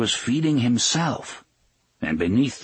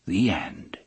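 soft; 6 semitones lower, 6 dB quieter, much slower than usual. A narrator's reading. Closing line echoes the soft voice.

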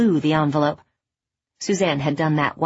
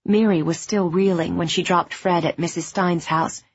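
plain reading voice

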